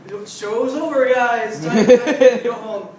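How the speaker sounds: slow, loud, flustered dismissal; priest dismissing. Retold or imitated. Priest ending the wedding, slow and loud. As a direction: shoo the guests out slowly. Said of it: "they're just like".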